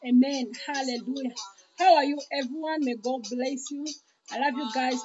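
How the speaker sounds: ordinary talk, nothing marked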